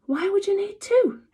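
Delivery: knowing, high amused mum voice; Mum's voice. A narrator's reading. Mother asking her son, amused and knowing.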